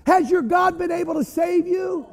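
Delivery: high, loud call; yelling into the den. Acted. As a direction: call down anxiously to someone far below. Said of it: "He yells into the den".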